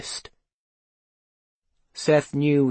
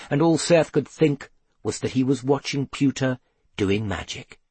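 usual speaking voice

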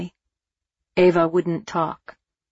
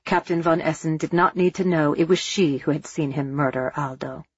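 usual speaking voice

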